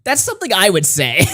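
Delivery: ordinary talk, nothing marked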